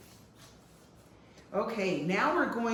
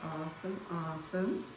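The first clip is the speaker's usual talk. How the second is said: ordinary delivery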